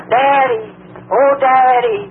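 high-pitched, pitiful calling; pathetic cry. Retold or imitated. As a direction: imitate a lost girl calling her father. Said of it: "Most pathetic cry".

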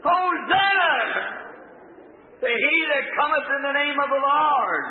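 high, drawn-out crowd acclamation; hollered praise. Retold or imitated. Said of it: "And others are hollering".

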